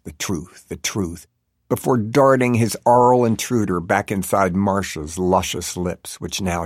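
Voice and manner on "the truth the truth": soft, low murmur; hushed, intimate repetition. A narrator's reading. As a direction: murmur it softly, repeating.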